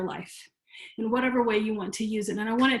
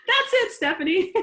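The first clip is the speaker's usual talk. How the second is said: laughing; 10 semitones higher, 5 dB louder than usual. Real, not acted. Laughing with a student.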